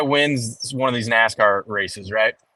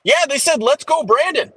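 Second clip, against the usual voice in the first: higher, breezy reporter voice covering it up; imitating the interviewer lady; 11 semitones higher than usual. Mocking the reporter's cover-up for his friend, higher. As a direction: chirp it brightly, keeping it cool. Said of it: "she goes".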